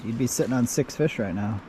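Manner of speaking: normal talking voice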